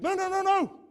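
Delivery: shrill old lady refusing help, hammed up; insistent old lady. Preacher voicing the old lady insisting at his door, very high and loud. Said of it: "And she goes".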